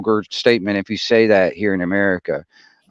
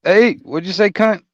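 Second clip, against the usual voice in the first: pitched up, cheeky Kiwi taunt; mock New Zealand insult, pitched up; mock Kiwi jab; 9 semitones higher than usual. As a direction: throw it out like a rowdy Kiwi kid.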